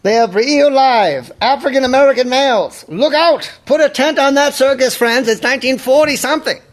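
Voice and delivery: put-on high, fast old-timey announcer; 1940s announcer. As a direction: hype it like an old newsreel. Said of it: "Forties announcer".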